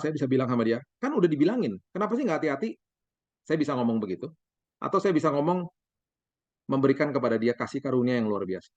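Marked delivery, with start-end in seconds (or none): 1.0-2.7 higher, scolding nag at a careless son; a taste of the angry dad; mock scolding. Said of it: "Saya bisa marah-marah". (omelan ayah yang pura-pura marah)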